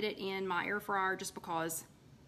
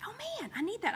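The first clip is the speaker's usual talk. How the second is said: excited inner voice; 12 semitones higher, faster than usual. Retold or imitated. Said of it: "I was like".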